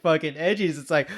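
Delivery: usual speaking voice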